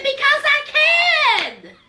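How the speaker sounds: high, long drawn-out retort; hammed-up retold defiance; mock defiant comeback. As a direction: stretch it out high and cocky, imitating her. Boston's defiant comeback, acted out.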